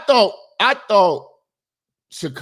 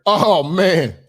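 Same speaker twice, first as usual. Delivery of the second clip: ordinary talk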